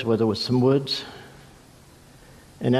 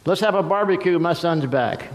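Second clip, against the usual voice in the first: jubilant father; 4 semitones higher than usual. A narrator's reading. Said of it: "Throwing a barbecue for him".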